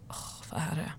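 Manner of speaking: hushed, voiceless sigh of a reply; retold weary sigh. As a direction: breathe it out under your breath, fed up. Retells her weary phone answer. (uppgiven, viskande suck)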